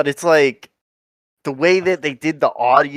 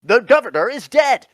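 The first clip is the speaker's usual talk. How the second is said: much higher, loud dramatic cry; mock yell; 12 semitones higher, 4 dB louder than usual. Retelling the movie's climax, loud hammed-up cry. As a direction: ham up a panicked announcement. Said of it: "he doesn't look like he's yelling, but he's like".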